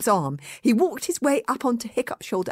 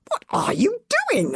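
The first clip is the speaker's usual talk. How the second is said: curious; 13 semitones higher than usual. Acted. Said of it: "asked Fishlegs curiously".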